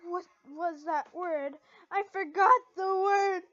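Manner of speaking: slow, high, very loud frantic wail. Acted. Lucy panicking over a forgotten word, wailing high and loud. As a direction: cry out in panic, hands over head.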